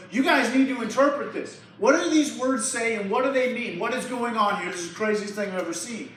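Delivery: loud, high frantic demand; the king shouting in alarm at his wise men; panicked king. Acted. King demands answers from his wise men, loud and frantic.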